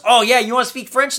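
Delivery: loud, high taunting challenge; mock Cena taunt. Cena taunting Owens for speaking French, loud and high. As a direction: throw it back at him as a dare. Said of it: "John Cena goes".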